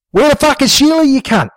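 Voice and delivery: high, furious shout; angry yell. A narrator's reading. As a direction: yell it at Jimbo. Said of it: "Dingo yelled".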